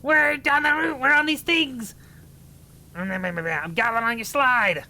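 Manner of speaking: gleeful goblin crowing over the slide. Acted. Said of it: "For the voice, it's goblin time".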